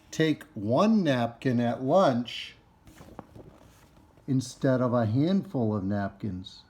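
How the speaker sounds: slow, stretched-out key words in a read-aloud; reading to children. Acted. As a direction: read each phrase out deliberately for children. Reads book to children.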